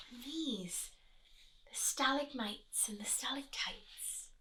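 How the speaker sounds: hushed wondering whispers. Real, not acted. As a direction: whisper as if inside the cave. Whispered cave aside to child.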